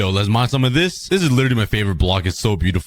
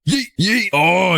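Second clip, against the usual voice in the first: much higher goofy yell; playful yeet; 7 semitones higher, slower than usual. Pretend. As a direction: toss the words out like you're throwing something. Throwing items away playfully.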